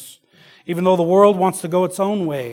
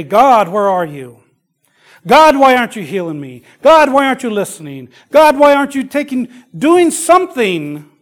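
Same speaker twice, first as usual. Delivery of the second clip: louder and climbing higher on each "God"; desperate plea; 7 semitones higher, 8 dB louder than usual. Retold or imitated. Struggling believer crying out to a silent God, desperately. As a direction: cry out to heaven, building in pitch.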